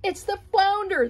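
high alarmed yell. Acted. Lobster yelling the alarm to the others. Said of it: "the lobster yelled".

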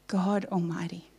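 usual speaking voice

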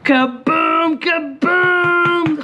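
high, booming victory cry; drawn-out triumphant holler; celebratory shout. Real, not acted. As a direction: sing it out high like an explosion of joy. Celebrating a win on the ticket.

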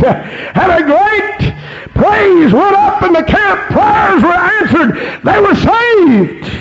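high-pitched revival shout, climbing as it goes; fervent preaching. Real, not acted. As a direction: build into an exultant, high preacher's cry. Preaching builds to full force.

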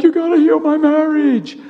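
mock insecure plea, high and rising; desperate plea. Preacher mimicking his old insecure prayer for his marriage, desperate plea. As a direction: imitate an anxious, high-pitched begging prayer.

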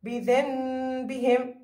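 بذنبهم with the ن turned into a long hummed m; slow demonstration of بذنبهم, holding the m sound; iqlab pronunciation. Shown as a demonstration. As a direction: draw out a nasal m before the ب in بذنبهم. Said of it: "كيف ألفظها؟".

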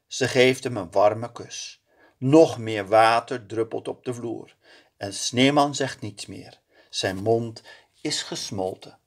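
ordinary delivery, nothing marked

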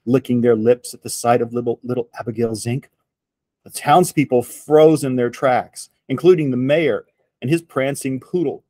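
plain reading voice

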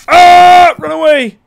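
high, loud panicked yell; terrified scream. Real, not acted. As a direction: scream and run in real fear. Flees monster in game.

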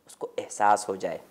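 ordinary talk, nothing marked

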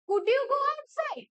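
deliberate teacher's example question. Shown as a demonstration. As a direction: ask "Could you go outside?" with an emphatic lift. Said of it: "তুমি একবার এমন ভাবে question করছো".

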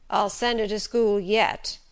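slow, teasing threat with a smile; a light tease with a hint of the gruff master; smiling mock threat. A narrator's reading. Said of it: "but now he was smiling".

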